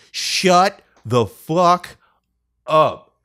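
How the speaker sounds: slow, word-by-word mock rage; restrained retold yell. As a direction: imitate a furious yell, held back for the mic. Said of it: "I didn't say it like that".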